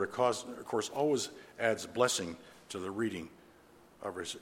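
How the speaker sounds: usual speaking voice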